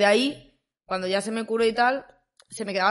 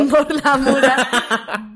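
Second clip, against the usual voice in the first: Borla a mura repeated slowly, laughing; slow laughing echo of Borla a mura; 2 semitones higher, 7 dB louder, much slower than usual. Shown as a demonstration. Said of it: "Burla a mora".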